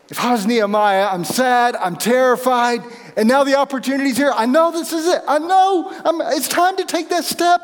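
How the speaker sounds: rising from plain to high, excited and fast; building excitement. Pretend. Preacher voicing Nehemiah's rising excitement.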